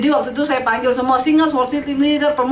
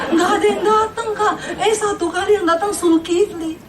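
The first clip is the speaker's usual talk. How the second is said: laughing; 7 semitones higher than usual. Retold or imitated. Retelling joke with laughter.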